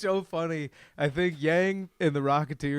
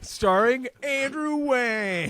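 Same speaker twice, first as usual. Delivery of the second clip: slow, high, drawn-out cast announcement; spoof show announcer; 7 semitones higher, slower than usual. Pretend. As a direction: stretch the star's name like an announcer.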